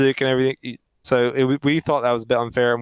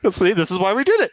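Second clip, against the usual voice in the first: quick, higher-pitched playful justification; mock vindication; 6 semitones higher, faster than usual. Co-host joins the joke as the filmmakers, mock vindication. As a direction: chime in as the makers, fast and high.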